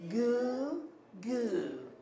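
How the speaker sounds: put-on two-note baby coo, up then down; baby's victory cry. Shown as a demonstration. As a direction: lead a cooed baby goo. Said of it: "that was the baby's victory cry".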